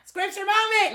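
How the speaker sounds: high, loud segment call-out; excited announcement. Pretend. Host heralding a Bible verse to co-hosts, excited announcement. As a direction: shout it like a show segment title.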